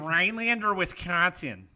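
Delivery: put-on Wisconsin accent, drawn-out vowels; hammed-up Wisconsin native. Shown as a demonstration. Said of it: "Now we're getting into Wisconsin".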